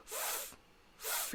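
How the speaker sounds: voiceless "f", just air; F sound. Shown as a demonstration. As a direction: blow a bare "f" through lip and teeth without voice. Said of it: "the same as the F sound".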